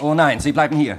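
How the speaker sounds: sharp loud stop at gunpoint; loud command. Acted. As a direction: block his exit with the voice. Stopping him from leaving.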